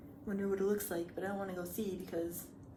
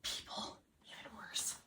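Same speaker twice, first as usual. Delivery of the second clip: soft whispered joke; whispered aside; 10 dB quieter, slower than usual. Real, not acted. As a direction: whisper the punchline.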